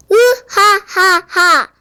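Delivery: slow, booming evil laugh; booming giant laugh. Acted. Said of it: "골리앗의 울렁창 목소리가 또 들려왔어요".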